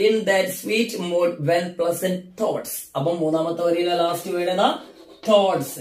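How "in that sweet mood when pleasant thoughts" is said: very slow, careful recital; slow poetry reading. Shown as a demonstration. Teacher reciting the third line slowly for the class. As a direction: read each word out slowly. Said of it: "മൂന്നാമത്തെ വരിയിൽ എന്താ പറഞ്ഞത്".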